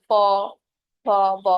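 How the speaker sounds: প ফ ব said alone, lips pressed together; teacher repeating lip sounds প ফ ব; lip consonant demo. Shown as a demonstration. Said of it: "ঠোঁট দিয়ে উচ্চারণ করি".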